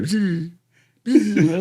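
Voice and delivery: a buzzing "bzz, bzz" like bees whizzing past; imitated bullet buzz. Telling cop buddies about rounds buzzing by, imitated bzz. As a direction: mimic bullets buzzing overhead, amused. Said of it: "you can hear".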